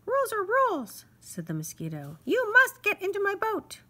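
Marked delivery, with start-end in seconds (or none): 0.0-1.0 high, louder little-insect voice, officious; fussy mosquito. Acted. Mosquito insists on the ferry rule, high and officious. 2.2-3.8 high, louder little-insect voice, officious; fussy mosquito. Acted. Mosquito insists on the ferry rule, high and officious.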